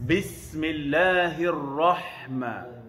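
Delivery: Bismillahirrahman- drawn out very slowly, each syllable long; slow Quranic recitation. Shown as a demonstration. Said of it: "sama macam tadi".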